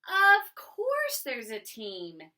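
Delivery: loud, slow, bursting with excitement; excited. Shown as a demonstration. Missus T Berry answering Mister Putter, loud and excited. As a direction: exclaim it like wonderful news. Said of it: "I need to say this with excitement".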